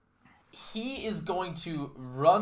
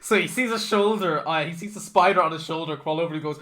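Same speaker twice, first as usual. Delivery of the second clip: ordinary talk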